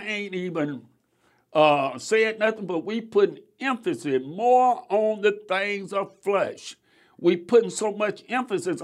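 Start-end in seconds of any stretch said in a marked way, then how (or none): none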